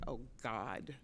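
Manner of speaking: low, soft oh God; muttered dread under breath. Retold or imitated. Dread as senior walks over.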